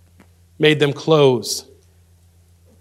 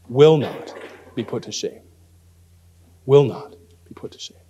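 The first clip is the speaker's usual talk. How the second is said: hushed preaching; 3 semitones lower than usual. Real, not acted. Preacher closing the sermon for believers, hushed and solemn. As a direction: let the closing promise sink to a hush.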